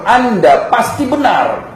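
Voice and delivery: high, slow, drawn-out proclamation; emphatic declaration of faith. Retold or imitated. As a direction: declare total conviction, stretching the words. Retold public declaration of faith. (pernyataan tegas, lambat dan bernada tinggi)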